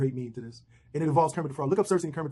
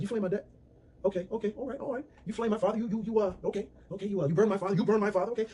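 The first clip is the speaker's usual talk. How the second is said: stunned disbelief; 6 semitones higher than usual. Retold or imitated. Sam told his father burned.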